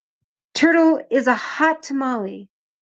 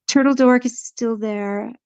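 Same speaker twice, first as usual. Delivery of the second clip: usual speaking voice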